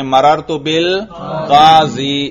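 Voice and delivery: slow, drawn-out مررت بالقاضی ending in "ee"; jarr reading. Shown as a demonstration. Teacher showing the genitive reading, hidden case ending. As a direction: stretch مررت بالقاضی, leave the final ya vowelless. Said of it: "اور جر کی صورت میں".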